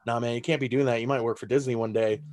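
lightly voiced voice of caution, low; cautioning shoulder figure. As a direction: talk them out of it in a low voice. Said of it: "the two figures on the shoulder".

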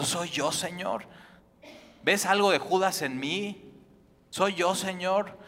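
slow searching plea. Acted. Listener pleads self-examination.

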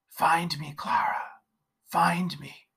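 soft, eerie, half-whispered call; a lost spirit calling from nowhere; ghostly whisper. Acted. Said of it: "disembodied voice".

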